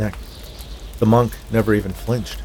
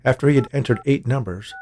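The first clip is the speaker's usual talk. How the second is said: ordinary delivery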